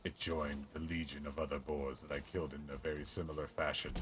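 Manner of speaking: low, menacing villain boast; low evil villain voice. Acted. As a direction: gloat darkly like a storybook villain.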